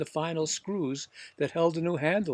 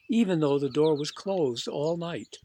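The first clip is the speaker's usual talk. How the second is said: ordinary delivery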